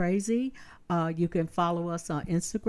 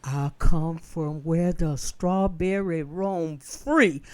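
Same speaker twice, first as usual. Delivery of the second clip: normal talking voice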